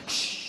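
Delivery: long, firm "shh"; shushing. Retold or imitated. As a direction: shush them down the phone. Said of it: "she shushed me".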